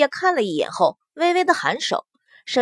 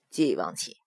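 very low, soft male voice; courteous young lord; 10 dB quieter than usual. A narrator's reading. Long Chen yields to the prince, very low and soft. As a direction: quietly return the courtesy, deep down.

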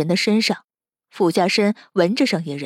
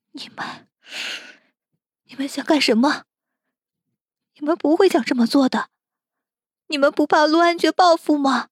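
gasped start, rising higher and quicker; frightened protest; 6 semitones higher than usual. Acted. Captive sees the men enter, frightened protest. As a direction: build from breathless fear to urgent warning. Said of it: "却是让他最害怕".

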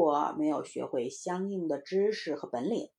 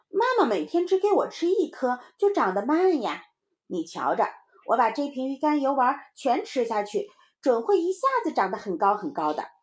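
put-on higher, emphatic kid explaining; boasting little child; 5 semitones higher, 4 dB louder than usual. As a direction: talk your friend into it like a child. (小孩高声得意地解释)

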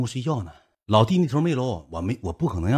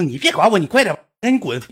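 impatient; 7 semitones higher, 5 dB louder than usual. Retold or imitated. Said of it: "那女的还说：".

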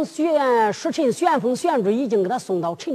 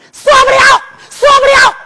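哭喊; 13 semitones higher, 11 dB louder than usual. Acted. 小王爷反抗时哭喊.

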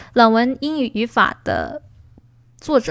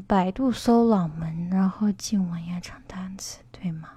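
whispered read of a chat question, softer; reading under her breath; 2 semitones lower, 7 dB quieter than usual. Real, not acted. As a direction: murmur the viewer's question to yourself. Reading a viewer's question aloud.

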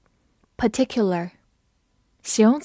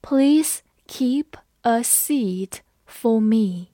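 slow, evenly separated words; slow read-along; much slower than usual. Shown as a demonstration. Teacher asks learners to follow along, slow word-by-word reading. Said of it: "跟我慢读一遍".

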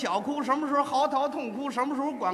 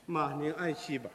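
hushed, lower mock mourning; 9 semitones lower, 9 dB quieter than usual. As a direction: murmur it like a grieving son. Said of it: "我就是这个哭老师".

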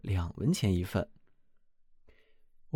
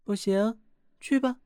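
much higher, curt dismissive princess; 11 semitones higher than usual. Acted. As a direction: brush the suitor off from above. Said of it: "国王的女儿说道". (公主高声冷淡地打发人)

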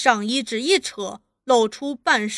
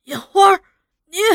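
high, loud broken call; crying out; 7 semitones higher, 8 dB louder, faster than usual. A narrator's reading. Half-conscious father calling daughter, crying out. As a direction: call out to her in distress. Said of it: "在昏迷的状态中叫着".

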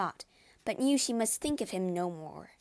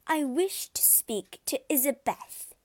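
haughty declaration; 6 semitones higher, 3 dB louder than usual. A narrator's reading. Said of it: "she declared".

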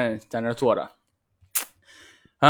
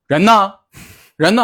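retold stern call; 5 semitones higher, 6 dB louder than usual. Teacher demanding where students are.

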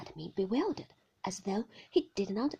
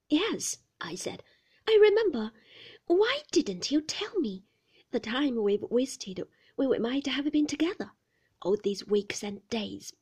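anguished outburst; 4 semitones higher, 7 dB louder than usual. Acted. Heroine bursts out to Maxim.

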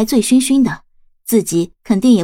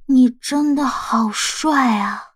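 very slow, woozy drawl; tipsy and muddled; 3 semitones higher, much slower than usual. A narrator's reading. Drunk boy repeats his compliment to his boyfriend, slow and muddled. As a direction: stretch the words out in a drunken daze. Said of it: "迷迷糊糊地又说了一遍".

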